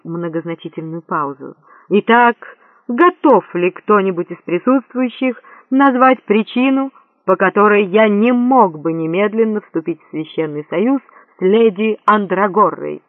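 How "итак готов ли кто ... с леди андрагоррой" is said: ceremonial challenge, raised and ringing; pompous proclamation. A narrator's reading. Prince asking guests for objections, pompous. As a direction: put it grandly to the crowd.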